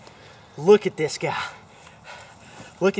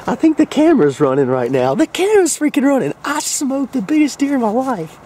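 shaking with adrenaline, loud and high; adrenaline shakes; 8 semitones higher, 7 dB louder than usual. Real, not acted. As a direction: voice shaky from the rush. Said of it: "I'm freaking shaking".

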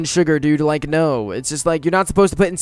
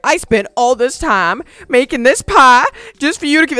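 lightly voiced upset grandma; a touch of hurt scolding; mildly mad grandma; 7 semitones higher, 4 dB louder than usual. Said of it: "she got kinda mad at me".

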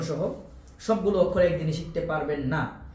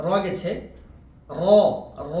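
usual speaking voice

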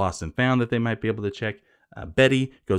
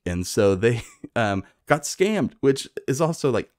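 normal talking voice

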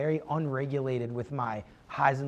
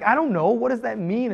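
higher, louder panicked questions; panicked confusion; 7 semitones higher, 6 dB louder than usual. Retold or imitated. Retelling his panic over the boss's text, higher and louder. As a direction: fret out loud, voice jumping up.

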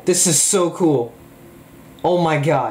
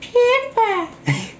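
much higher, slow, stretched name call; PewDiePie impression; much slower than usual. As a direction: squeal the name like a hyper YouTuber. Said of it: "I can even do PewDiePie".

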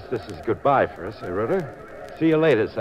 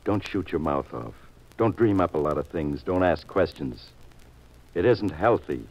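low menacing warning; 8 semitones lower, 3 dB quieter than usual. Acted. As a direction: lean in and warn him off quietly. Thug threatens the detective.

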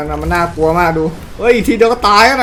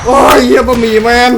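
exclaiming shout; 3 semitones higher, 7 dB louder than usual. Real, not acted. Exclaiming at game character.

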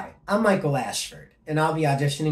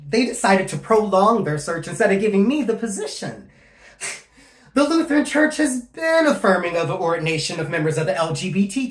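raised, high-pitched indignation; aggrieved; 5 semitones higher, 6 dB louder than usual. Acted. Candidate protesting being passed over as pastor, aggrieved. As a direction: voice climbing with hurt frustration.

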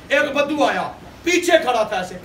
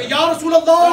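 higher, louder cry to the congregation; loud devotional call; 6 semitones higher, 7 dB louder, slower than usual. Real, not acted. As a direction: call out with full voice. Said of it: "زور سے کہ". (زور سے پکار کر)

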